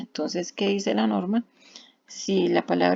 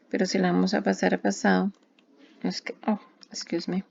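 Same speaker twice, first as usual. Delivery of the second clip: normal talking voice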